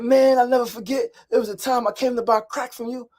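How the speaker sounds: high-pitched, effusive reminiscing; imitated tipsy woman. Drunk Janae reminiscing in front of the niece, effusive. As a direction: mimic her fond, tipsy storytelling. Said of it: "She's drunk".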